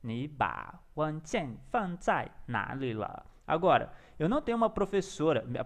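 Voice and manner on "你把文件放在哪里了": slow, word-by-word Mandarin repetition; careful pronunciation practice. Shown as a demonstration. Learner repeating the app's question, slow and careful. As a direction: sound out each syllable slowly.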